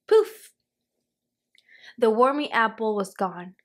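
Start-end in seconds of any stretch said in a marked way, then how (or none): 0.1-0.5 magic poof. A narrator's reading. Magic spell takes effect.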